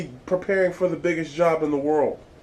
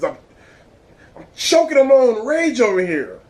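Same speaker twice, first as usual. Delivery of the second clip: Moe quote; 8 semitones higher, 5 dB louder than usual. Retold or imitated. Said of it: "What did Mo say on that episode".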